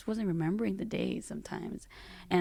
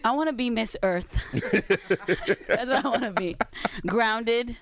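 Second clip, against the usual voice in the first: playful pageant dream; 4 semitones higher, 5 dB louder, slower than usual. Pretend. Joking about dream of pageant title.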